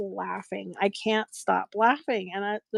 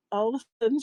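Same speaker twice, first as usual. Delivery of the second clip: ordinary talk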